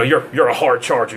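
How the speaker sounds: ordinary delivery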